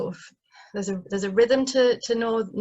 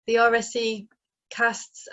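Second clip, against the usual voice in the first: normal talking voice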